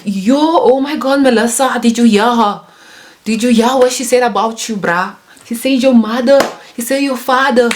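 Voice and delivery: put-on high, egging-on Coloured-accent impersonation; South African Coloured accent. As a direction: stir up a friend in a Coloured accent. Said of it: "not everybody has this colored accent".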